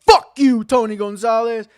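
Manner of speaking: high, loud cursing blast; furious shout. Retold or imitated. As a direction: yell it right in his face. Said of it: "He's like".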